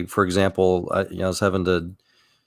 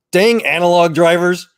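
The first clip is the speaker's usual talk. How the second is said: mock grumpy grandfather; 8 semitones higher, 6 dB louder than usual. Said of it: "he just says something like".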